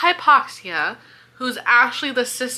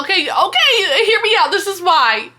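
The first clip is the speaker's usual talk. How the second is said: high, louder eager build-up; excited theory pitch; 7 semitones higher, 4 dB louder than usual. Real, not acted. Reader pitching her theory to viewers, excited and eager. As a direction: rush in to share the big idea.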